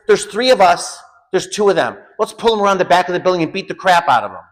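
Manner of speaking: heated, high-pitched urging; rally the others, worked up and mad; retold anger. Urging friends against the cops, retold mad outburst. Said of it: "I was so mad".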